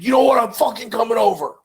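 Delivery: caller's angry threat; angry stranger. Retold or imitated. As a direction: play the furious caller threatening to come over. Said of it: "increasingly gets more angry".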